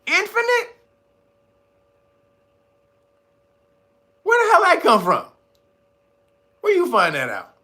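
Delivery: very high-pitched disbelief; incredulous. Acted. Questioning the infinite answer, incredulous. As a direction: squeal the question as if floored.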